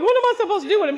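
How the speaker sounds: high, loud and bewildered; flustered disbelief; baffled high exclamation. Retold or imitated. Retold baffled reply.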